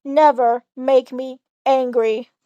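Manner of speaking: slow, low villain threat; menacing. Acted. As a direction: lay down the threat slowly. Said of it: "Evil Zud pauses".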